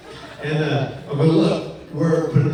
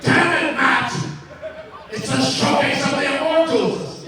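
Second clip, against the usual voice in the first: angry Vince; 10 semitones higher than usual. Retold or imitated. Vince yelling at Matt on the phone, high and angry. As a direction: rant down the phone like an irate boss. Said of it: "and he goes".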